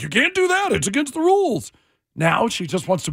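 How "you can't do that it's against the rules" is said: high, mock-prim scolding; prissy rule-follower. Host imitates coworker Kayla as a prim stickler, high mock scold. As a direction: mimic a fussy stickler, pitched up. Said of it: "Kayla's like".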